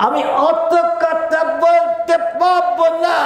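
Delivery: high, slow, irritated refusal; আমি অত কথা বলতে পারবো না said high and slow, annoyed; angry retort. Retold or imitated. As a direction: snap back like an annoyed old mother. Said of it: "এক সময় মা রেগে গিয়ে".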